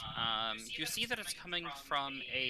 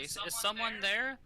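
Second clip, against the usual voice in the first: distant muffled call, higher; 9 semitones higher than usual. Acted. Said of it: "like, very muffled voice say".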